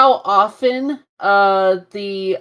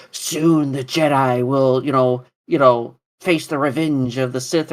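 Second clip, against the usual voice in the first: Darth Maul voice; 8 semitones lower than usual. Retold or imitated. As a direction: growl it out like a villain. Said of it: "Darth Maul's like".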